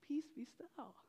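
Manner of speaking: soft, hushed and still; quiet voice of God calming; calm divine voice. Retold or imitated. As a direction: speak peace over the storm gently. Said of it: "And God just said".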